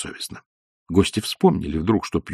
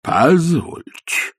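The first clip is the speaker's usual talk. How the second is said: протяжное удивлённое «Позвольте»; растянуть слово с возгласом протеста; восклицание; 6 semitones higher, much slower than usual. A narrator's reading. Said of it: "воскликнул вдруг Чарушников". (удивлённо воскликнуть)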